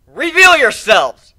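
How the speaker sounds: loud, high, slow yell; yelling into forest. A narrator's reading. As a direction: call out to hidden enemies. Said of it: "I yelled into the forests".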